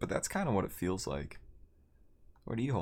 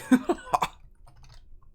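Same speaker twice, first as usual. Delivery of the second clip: high giggle; 4 dB louder than usual. Real, not acted. Laughing at chat.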